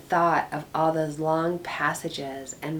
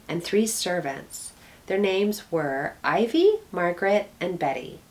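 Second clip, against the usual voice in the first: plain reading voice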